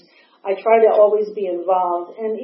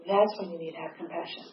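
much softer, hushed patient lecture; serene sponsor; 4 semitones lower, 12 dB quieter, faster than usual. Retold or imitated. Sponsor Linda lecturing Maggie on compassion, hushed and patient. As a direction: coo it like a saintly mentor.

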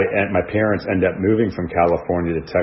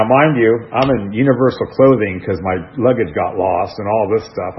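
usual speaking voice

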